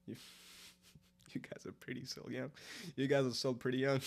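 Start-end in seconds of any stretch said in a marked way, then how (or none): 0.1-2.5 laughing whisper; breathy giggling whisper. Real, not acted. Whispered repeat to chat.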